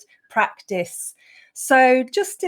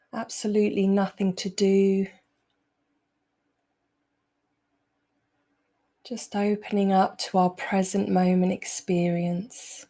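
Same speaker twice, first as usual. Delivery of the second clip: unhurried meditation voice, long pauses between phrases; guided meditation; 2 semitones lower than usual. Acted. Teacher easing the group out of a busy day, guided meditation. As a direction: guide them calmly into the present moment.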